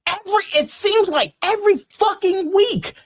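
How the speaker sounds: high, strained rant; pushing the voice hard in a rant. Real, not acted. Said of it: "I'm losing my voice".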